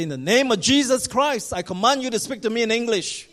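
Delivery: loud, high exorcism command. Retold or imitated. A preacher commanding the demon to speak English, forceful. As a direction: order the spirit with full authority.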